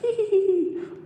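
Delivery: put-on high childish laugh; giggling little girl; girl's giggle. A narrator's reading. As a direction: giggle like a ten-year-old. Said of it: "Winnie's turn to laugh".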